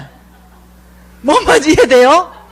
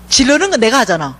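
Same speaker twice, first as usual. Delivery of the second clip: ordinary talk, nothing marked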